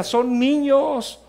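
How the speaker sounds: son niños stretched out slow, high and loud; slow emphatic stress. Acted. Pastor pleading with parents that they are children, niños stretched slow and loud. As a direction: lean hard on son niños, drawing it out. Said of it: "preacher slows on key phrase".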